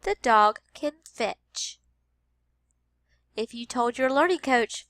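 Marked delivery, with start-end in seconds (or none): 0.0-1.8 slow The dog can fetch; slow teacher read-aloud; slow modeled sentence. Shown as a demonstration. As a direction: repeat slowly. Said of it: "We're listening for the word".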